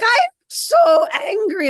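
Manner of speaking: high, loud, slow mock fury at the students; retold angry outburst. Yoga teacher storming back across the road, high, loud mock rage. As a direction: spit out each word like an angry teacher. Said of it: "She's like".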